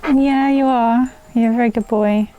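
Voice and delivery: praising pet talk. Real, not acted. Praising her dog.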